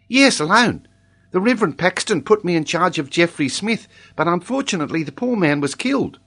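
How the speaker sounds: put-on high-pitched, earnest child with accent; Tolini. A narrator's reading. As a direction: explain it as a little accented boy, up high.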